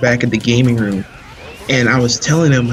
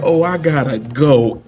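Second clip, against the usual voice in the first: slow mock panic; lightly voiced friend bailing; imitating Chris. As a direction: repeat his hasty exit line, drawn out. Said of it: "she just went".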